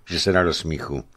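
ordinary delivery

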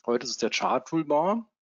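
normal talking voice

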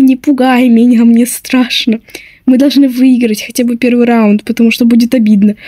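ordinary delivery

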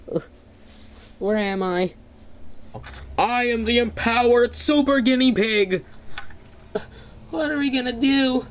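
normal talking voice

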